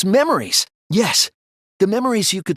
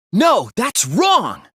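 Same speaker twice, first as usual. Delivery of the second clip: loud, high, slow rebuttal; shouted objection; 6 semitones higher, 5 dB louder, much slower than usual. Acted. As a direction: cut them off with a firm objection. Objection shouted in class trial.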